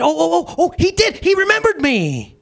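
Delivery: high, joyful outburst; overjoyed. Acted. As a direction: burst out in pure joy. Thief overjoyed at being remembered.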